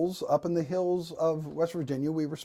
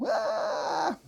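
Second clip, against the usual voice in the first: high-pitched mock bawl of a small child; whiny child's wail; 8 semitones higher than usual. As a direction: wail like a whining preschooler. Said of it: "And I'm crying and whining".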